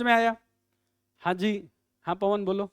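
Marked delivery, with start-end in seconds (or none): none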